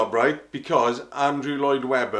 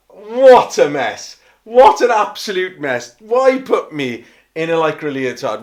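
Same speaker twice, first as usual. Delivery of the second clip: mock outrage; 5 semitones higher, 11 dB louder than usual. Looking at his old poster, loud mock horror. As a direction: cry out in hammed-up dismay at the poster.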